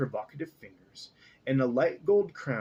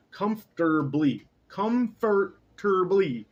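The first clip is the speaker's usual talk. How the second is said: comfortably stretched slowly syllable by syllable; slow sounded out; 6 semitones higher, 5 dB louder, slower than usual. Shown as a demonstration. Reader slowly drilling the word, slow careful comfortably. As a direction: spell it out with your mouth. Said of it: "That's how you say that".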